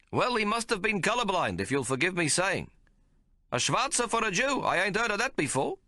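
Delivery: high, incredulous little man; a small man who can't believe it; puzzled tailor. A narrator's reading. Tailor sniffing disbelief.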